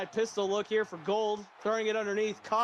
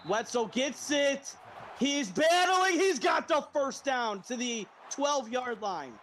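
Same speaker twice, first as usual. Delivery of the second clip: excited call; 5 semitones higher, slower than usual. Real, not acted. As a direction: stretch out the big run. Exciting run play call.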